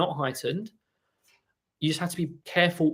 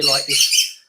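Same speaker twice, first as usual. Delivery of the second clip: imitated strangled squeak; 8 dB louder than usual. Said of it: "like, a strangled squeak almost".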